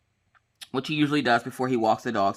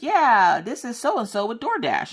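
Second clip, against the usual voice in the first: high chirpy caller; 9 semitones higher, slower than usual. Retold or imitated. Voicing the DoorDash caller.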